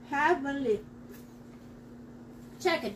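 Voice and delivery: normal talking voice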